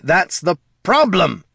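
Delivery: high, drawn-out shout; exasperated yell. A narrator's reading. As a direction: cut them off with a yell. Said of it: "yelled the lich".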